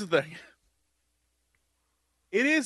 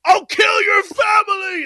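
much higher, louder, slow mock yell; mock trailer scream; 13 semitones higher, 8 dB louder, slower than usual. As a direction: shriek it like a hammy villain in a promo. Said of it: "He's like".

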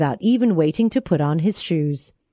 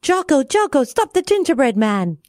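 calling out; 6 semitones higher than usual. Acted. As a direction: call out to the monkey. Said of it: "heard the cook calling".

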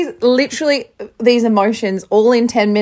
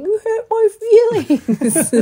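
put-on much higher, sweet toddler voice; cute child voice; 14 semitones higher than usual. Retelling son's words to dad, higher cute toddler voice. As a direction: say it like a small hurt child. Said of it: "him saying".

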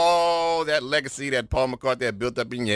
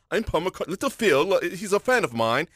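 mock English accent; 5 semitones higher than usual. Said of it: "that air of".

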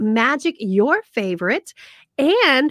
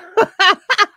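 high loud laugh; 10 semitones higher, 9 dB louder than usual. Real, not acted. Laughing at own impression.

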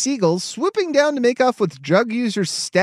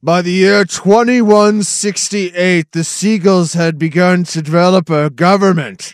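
mock documentary narration; 4 dB louder than usual. Mock documentary about seagull evolution.

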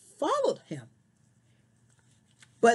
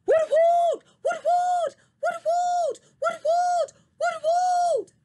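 hooting owl, much higher and louder; loud owl hoots; 4 dB louder than usual. Acted. Owl hoots loudly to scare the barking dog away. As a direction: yell it out like a big owl scaring a dog. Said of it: "with a loud voice, he yelled".

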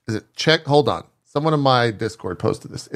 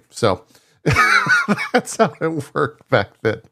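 usual speaking voice

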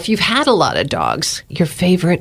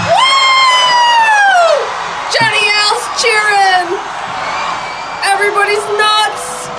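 excited cheering; much slower than usual. Real, not acted. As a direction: cheer it out with joy. Cheering early spring announcement.

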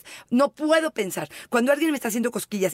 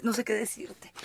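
trailing off into a whisper; 9 dB quieter than usual. Real, not acted. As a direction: breathe it out, speechless. Speechless at criminal fetish.

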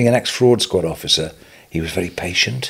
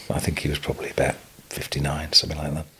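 whispered, softer, trailing off in sorrow; real sad whisper; 5 semitones lower, 7 dB quieter, faster than usual. Real, not acted. Recalling his mentor's early death, a sad whisper. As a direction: hush the words as if grief closes the throat.

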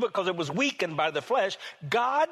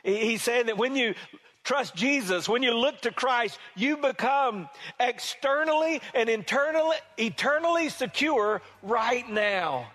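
impassioned preaching, higher pitch; 7 semitones higher than usual. Acted. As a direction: preach it with rising fervor. Climactic preaching over music.

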